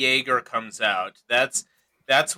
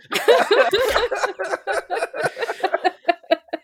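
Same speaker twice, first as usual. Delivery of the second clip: loud high laugh; 3 dB louder than usual. Real, not acted. Group cracking up.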